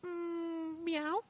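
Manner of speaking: high squeaky meow; cat voice. Pretend. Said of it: "do a cat voice".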